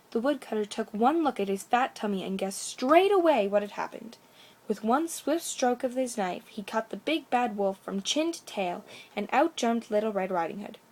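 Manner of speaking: ordinary delivery, nothing marked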